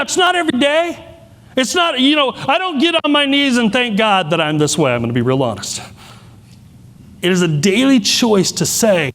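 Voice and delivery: ordinary talk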